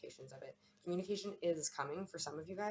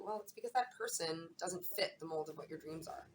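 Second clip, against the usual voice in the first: ordinary talk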